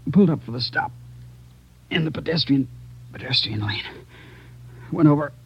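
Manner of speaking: weak, dying. Acted. Dying officer recounts shooting.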